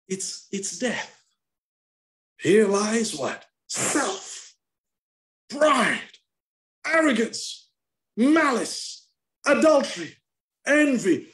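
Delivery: high, loud, slow list of sins, each word hammered; fiery preaching. Acted. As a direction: preach each sin out like a verdict. Preacher lists sins forcefully.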